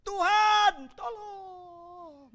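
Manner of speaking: Peter crying out as he sinks, voice breaking; a loud, breaking plea that fades off; drowning man's cry; desperate cry for help. Acted. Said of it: "doa paling singkat tuh doanya Petrus".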